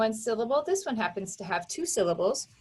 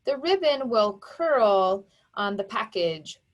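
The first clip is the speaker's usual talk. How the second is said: normal talking voice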